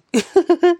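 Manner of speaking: a quick bright burst of laughter; amused laugh after welcoming them; short high laugh. Real, not acted. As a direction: laugh at your own joke. Laughs at own joke.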